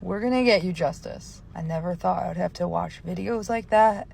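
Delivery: ordinary talk, nothing marked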